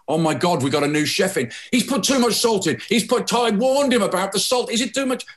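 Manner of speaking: panicked waiter. Acted. Waiter panics over the soup.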